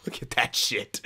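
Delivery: whispered in disbelief; awed whisper. Real, not acted. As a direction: breathe it out like you can't believe it. Pointing at the goalie stats.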